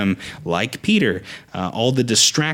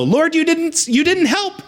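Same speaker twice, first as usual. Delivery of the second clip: much higher, whining reproach; whiny complaint. Pretend. Peter griping at the Lord as he sinks, high whine. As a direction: whine it like a let-down child. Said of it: "he didn't say".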